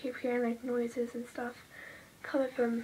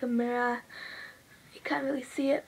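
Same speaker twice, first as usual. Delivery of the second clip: ordinary talk, nothing marked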